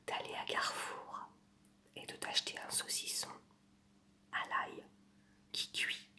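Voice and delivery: soft close-mic whisper, right in your ear; ASMR whisper. Pretend. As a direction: breathe every word into the mic like an ASMR video. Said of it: "je vais vous faire une imitation".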